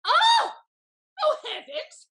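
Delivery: high, loud cry of horror; shocked cry. Acted. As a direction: cry out in dismay. Lady Wishford reads the betrayal.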